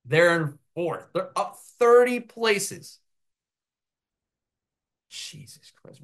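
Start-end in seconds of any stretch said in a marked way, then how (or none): 5.1-6.0 whisper. Real, not acted. Shocked at his own bad prediction.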